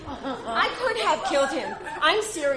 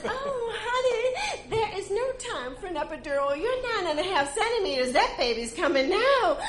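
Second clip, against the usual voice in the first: high, quick sugary nurse voice; a bright sing-song nurse; perky nurse; 9 semitones higher, faster than usual. Retold or imitated. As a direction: rattle off the news chirpily. Nurse announces.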